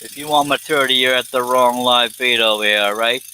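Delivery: put-on New York Italian wiseguy accent. Livestreamer showing off dialects for viewers, New York Italian accent. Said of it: "in a New York Italian accent".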